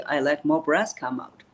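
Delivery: normal talking voice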